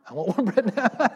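high, loud, drawn-out joke demand; slow, high-pitched mock yell; mock demanding shout. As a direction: playfully holler it like an impatient customer. Joking outburst about bread during sermon.

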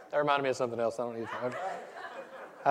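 ordinary talk

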